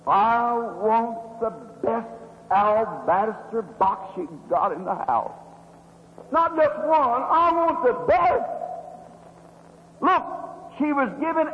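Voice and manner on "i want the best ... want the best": slow, firm, stress the word best; insisting on the best. Retold or imitated. Woman ordering the perfume, firmly insistent. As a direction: plant each word deliberately. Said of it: "She said".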